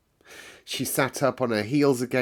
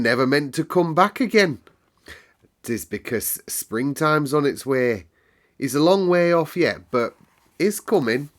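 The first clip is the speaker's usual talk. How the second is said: bright, higher Yorkshire lass, chatty and sure of herself; a cheerful Yorkshire servant girl telling the weather; Yorkshire maid Martha; 5 semitones higher, 6 dB louder than usual. Acted. Housemaid Martha explains the moor weather to Mary, lively Yorkshire lass.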